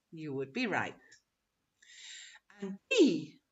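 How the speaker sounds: usual speaking voice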